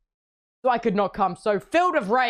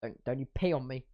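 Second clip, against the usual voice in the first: low hushed warning; 8 semitones lower, 9 dB quieter than usual. Retold or imitated. Scolding the cat.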